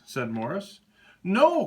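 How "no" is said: high, loud cry of no; the cow cries out in protest; cried out. Acted. Cow cries no at Morris, high and loud. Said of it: "cried the cow".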